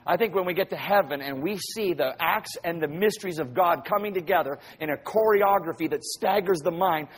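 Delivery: ordinary delivery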